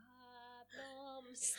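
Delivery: high, soft, dreamy marvelling; acted blissed-out awe; tripped-out wonder. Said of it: "kan jo sånn trippe sånn ut".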